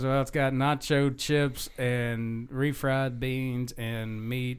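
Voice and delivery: slow item-by-item list; retold patient explaining. Teenage kid explaining the menu to a customer, slow patient listing. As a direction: list each item slowly, spelling it out.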